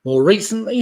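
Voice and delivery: ordinary talk, nothing marked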